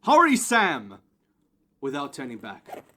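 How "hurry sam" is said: loud high urgent call; urgent shout. Acted. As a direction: yell him out the door. Urging Sam to run.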